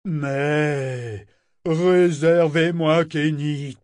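slow, far-off bellowing ship voice. A narrator's reading. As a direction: roar it slowly. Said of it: "Parangon poussa un beuglement".

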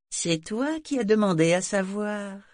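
high, taunting little voice; sarcastic little demon. A narrator's reading. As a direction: throw it back at him, high-pitched.

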